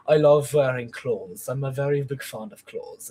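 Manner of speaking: put-on calm, proper German accent. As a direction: play a prim, zesty German. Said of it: "make himself very proper".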